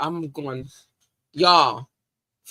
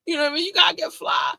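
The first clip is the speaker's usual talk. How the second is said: mock partygoer; 14 semitones higher, faster than usual. Acting out a partygoer getting dressed.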